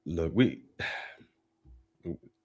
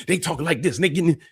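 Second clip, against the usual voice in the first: high, swooping singsong demo; exaggeratedly expressive, pitched up; expressive talker; 7 semitones higher, faster than usual. Shown as a demonstration. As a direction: imitate animated talk with pitch bouncing up and down. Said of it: "They expressive when they talk".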